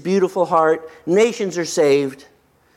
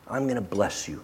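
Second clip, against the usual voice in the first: much softer, low and tender; tender forgiveness; 8 semitones lower, 10 dB quieter than usual. Retold or imitated. Joseph answering his brothers' treachery, soft and forgiving.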